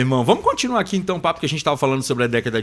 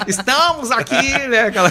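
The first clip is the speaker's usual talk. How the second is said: laughing Marília Gabriela intro; 8 semitones higher, 4 dB louder than usual. Pretend. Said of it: "entradinha do, do, da Marília Gabriela".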